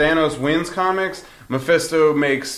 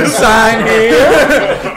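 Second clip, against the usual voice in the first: louder, laughing, higher; laughing devil voice; 8 semitones higher, 7 dB louder than usual. Retold or imitated. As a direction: offer the deal with a gleeful laugh. Voicing Mephisto's deal, laughing.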